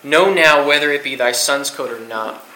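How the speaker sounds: measured, solemn Bible reading; solemn scripture reading to a youth group. Acted. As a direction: read the verses aloud with weight, slowing on the quote. Reads scripture aloud.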